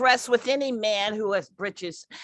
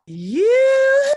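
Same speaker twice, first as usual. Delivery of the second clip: drawn-out high flirty call; 8 dB louder than usual. Acted. Flirty senior calls out to Becky.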